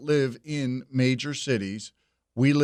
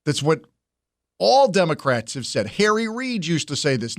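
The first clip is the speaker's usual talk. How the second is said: normal talking voice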